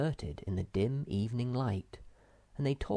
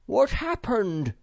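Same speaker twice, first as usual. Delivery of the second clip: high, loud, drawn-out call; Moomintroll; 11 semitones higher, 7 dB louder than usual. A narrator's reading. Moomintroll hears Sniff climbing up, calling out. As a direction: call down to someone out of sight. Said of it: "called Moomintroll".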